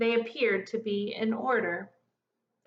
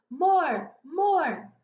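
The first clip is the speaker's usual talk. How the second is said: calling out loud and eager, higher; a crowd begging for more; crowd shouting; 6 semitones higher, 4 dB louder than usual. A narrator's reading. Shoppers cheering the act for more. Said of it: "a chorus of other voices shouting".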